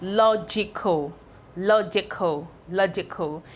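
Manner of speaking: "logical" said slowly, each syllable clear; slow model word. Shown as a demonstration. Teacher to pupils, modelling the new word slowly. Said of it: "the next word I would like to write now is".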